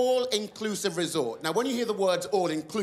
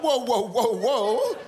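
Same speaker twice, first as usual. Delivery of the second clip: laughing whoa; 6 semitones higher, 5 dB louder, much slower than usual. Retold or imitated. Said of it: "I was like".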